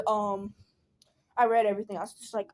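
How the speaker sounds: ordinary talk, nothing marked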